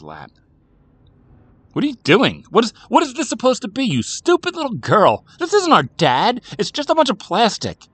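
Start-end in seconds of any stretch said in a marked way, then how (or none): 1.7-7.9 put-on high, loud, scolding child; angry outburst. A narrator's reading. Boy berating sister over plastic dad, angry outburst. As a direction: yell it at a little sister, outraged.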